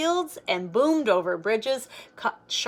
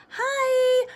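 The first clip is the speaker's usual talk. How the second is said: put-on high, loud little-kid hi; little girl's hi; 6 dB louder than usual. Toddler to big kids on bikes, high loud hi. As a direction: chirp it like a tiny child waving.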